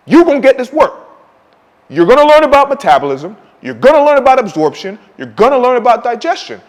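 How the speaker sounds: a coach laying down the law to the viewers; fired-up shouted sermon; heated rant. Real, not acted. Coach scolding the audience.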